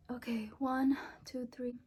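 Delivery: soft whispered count; whispered countdown. Real, not acted. Girl counting down to pierce, whispered.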